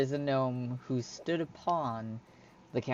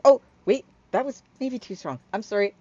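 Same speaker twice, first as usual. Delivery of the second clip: high, loud, flustered apology; excited Hyla catching herself; 7 semitones higher, 7 dB louder, faster than usual. Acted. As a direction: pull back from overexcitement, still pitched up. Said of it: "She gets all excited".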